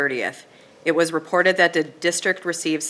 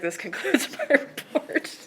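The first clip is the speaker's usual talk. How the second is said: laughing; 9 semitones higher, 5 dB quieter than usual. Real, not acted. Laughs while closing her report.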